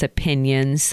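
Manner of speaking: usual speaking voice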